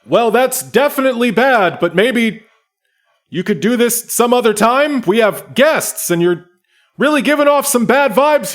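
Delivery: high, loud peacemaker voice; calm the fight, but loudly; a guide breaking up an argument among guests; Kurz stepping in. Acted. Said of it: "Kerr says".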